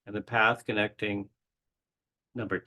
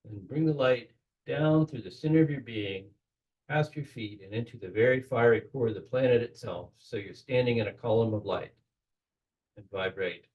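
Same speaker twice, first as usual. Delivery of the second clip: guided meditation. Acted. Guiding the group through the Kabbalistic cross, in a calm meditation voice. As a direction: lead the listeners gently into the ritual.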